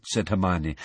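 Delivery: ordinary delivery, nothing marked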